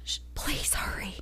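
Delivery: whispered plea, barely audible; hushed emergency-call whisper; urgent whisper. Acted. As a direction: whisper as if someone might overhear. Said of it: "Why are you whispering like that?".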